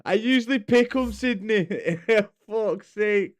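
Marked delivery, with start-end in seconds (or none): none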